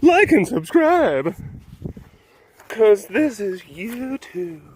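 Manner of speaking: high, slow, stretched-out plug; hammed-up YouTuber plug. Host begging viewers to subscribe, hammed-up. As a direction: parody a YouTuber, drawing out each word.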